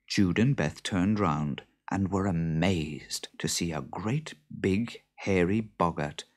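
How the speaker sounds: plain reading voice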